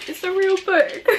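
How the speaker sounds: high, tearful laugh-cry of joy; laughing through tears. Real, not acted. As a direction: let the happy tears break into the words. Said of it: "I don't know if I've cried on camera before".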